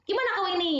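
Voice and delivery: imitating Batak directness; Batak accent. Lecturer imitating Batak speech for students, blunt Batak question. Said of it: "Biasanya seperti itu ya".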